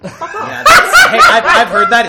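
high, loud burst of laughter through the words; laughing. Real, not acted. Friends teasing over a gifted record, laughing hard. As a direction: crack up while trying to talk.

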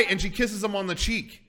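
ordinary talk, nothing marked

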